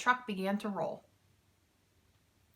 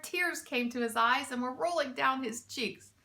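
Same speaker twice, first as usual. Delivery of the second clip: usual speaking voice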